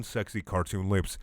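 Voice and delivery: ordinary delivery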